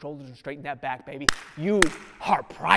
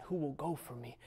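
hushed question; 2 semitones lower, 14 dB quieter than usual. Retold or imitated. Preacher retelling God's question to Isaiah, hushed and soft. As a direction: ask it almost under your breath. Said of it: "he heard the question".

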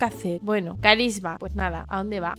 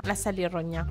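much softer, lower repeat of "la ha salido roña"; quiet echo; 5 semitones lower, 6 dB quieter, faster than usual. Real, not acted. Streamer repeating the gross remark under her breath, quietly. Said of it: "tiene roña".

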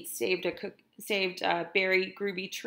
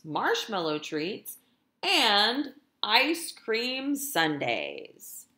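lively, sing-song storytime reading; animated picture-book narration; reading to children; 3 semitones higher, 3 dB louder, much slower than usual. A narrator's reading. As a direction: read it like a teacher to her class. Teacher reads picture book aloud.

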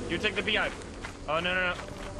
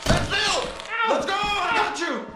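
urgent; 12 semitones higher, 3 dB louder than usual. Real, not acted. Rescuing teammate urgently.

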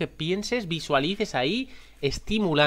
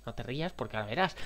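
ordinary delivery

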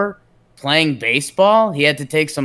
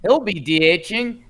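exaggerated Canadian accent slipping off course; drifting Canadian accent; 2 semitones higher than usual. Host losing track of the lineup bit, wobbly Canadian accent. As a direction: let the Canadian accent wander comically. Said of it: "What accent is this turned into?".

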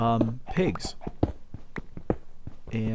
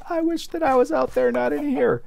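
much higher, whiny put-on voice; whiny trapped voice; 13 semitones higher than usual. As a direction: pine like someone stuck inside. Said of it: "spend the whole night in here going".

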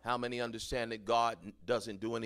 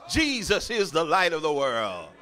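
loud, rising preacher's call; preaching at full force; 8 semitones higher, 9 dB louder than usual. Acted. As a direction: build it up and lift each phrase.

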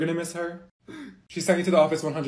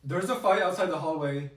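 ordinary delivery

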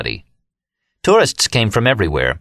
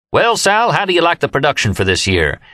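proud, much higher greeting; proudly; 8 semitones higher than usual. A narrator's reading. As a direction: beam with pride at the opera.

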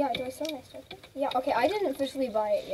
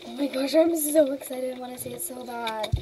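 ordinary talk, nothing marked

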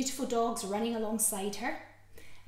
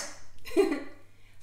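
breathy laugh; 8 semitones higher than usual. Real, not acted. Laughing after the rhyme.

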